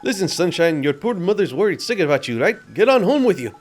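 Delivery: bossy kindly sidekick. Acted. Drippy sends child home.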